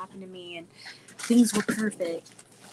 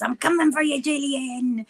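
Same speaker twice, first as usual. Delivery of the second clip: mock threat; 5 semitones higher than usual. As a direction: playfully imitate a wrestling challenge. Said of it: "it goes".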